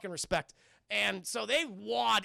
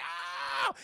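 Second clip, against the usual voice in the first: high, drawn-out ow-like scream; hammed-up yelp of agony; mock pained scream; 9 semitones higher than usual. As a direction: imitate a kid screaming in pain. Skin ripped off, Billy screams.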